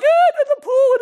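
very high, loud whiny mumble; hammed-up complainer avoiding the question; whiny dodge. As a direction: shrug it off in a whine. Whiner answers lazily.

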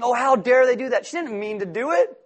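mock indignation. Mocking a grudge-holder.

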